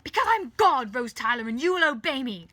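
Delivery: very loud, high commanding roar; furious, imperious yelling; megalomaniac shout. Acted. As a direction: demand obedience like a raging tyrant. Villain roaring his authority.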